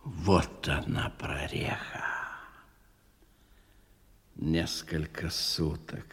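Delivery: man piecing the puzzle together alone; quiet musing. Acted. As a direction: murmur the thought to yourself. Thinking aloud alone.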